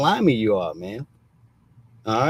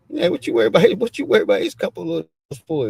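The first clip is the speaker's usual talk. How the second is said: mocking challenge; 7 semitones higher, much faster than usual. Taunting the wife over his money, high and fast. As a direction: needle her, pitched way up and rushed. Mock-questioning her jealousy.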